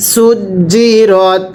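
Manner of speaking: সুজি রত said very slowly with a firm জ; slow drilled reading. Shown as a demonstration. Teacher drills সুজি রত slowly for students. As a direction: drag out সুজি রত, hardening the জিম. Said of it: "মিলিয়ে পড়বেন".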